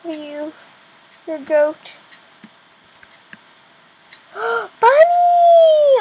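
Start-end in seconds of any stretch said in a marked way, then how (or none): 4.3-6.0 high, delighted cry; thrilled gasp. Real, not acted. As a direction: gasp then a much higher, loud Bunny. Spots bunny, thrilled.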